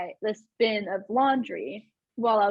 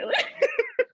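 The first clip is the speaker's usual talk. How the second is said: a high, squeaky burst of laughter; high squealing laugh. Real, not acted. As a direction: squeal with delight at the thought. Laugh after quote.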